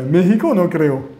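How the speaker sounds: normal talking voice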